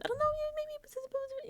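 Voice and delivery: very high, noncommittal mumbo-jumbo; waffling Vince. Retold or imitated. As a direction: imitate someone dodging the question in a squeaky high voice. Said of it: "his little run around".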